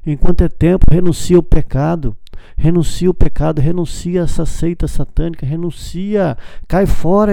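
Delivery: loud, urgent call to renounce sin; preaching at full force. Real, not acted. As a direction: preach it hard, pleading with the crowd. (pregação inflamada, em voz alta)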